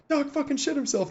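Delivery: high, thin mock holler; imitated yell. Gunners yell to each other across trucks, high mock yell. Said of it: "the gunners are just yelling at each other".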